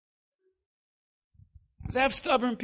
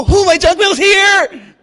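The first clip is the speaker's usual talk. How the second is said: mock excitement; 6 semitones higher, 14 dB louder, slower than usual. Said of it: "Do you go".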